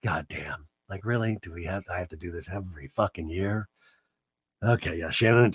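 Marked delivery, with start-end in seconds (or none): 0.0-3.7 low, soft, put-upon muttering; husband gazing at the sunset, low weary grumble. Retold or imitated. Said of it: "Terry looking off onto the sunset".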